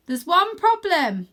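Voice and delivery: high, loud, slow worried voice; careful remark. Acted. Arthur on the tightrope rock, worried. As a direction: inch along, announce a snag. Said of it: "I say as I inch my way".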